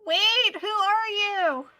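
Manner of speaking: loud, slow call after someone. Real, not acted. Calling after leaving NPC.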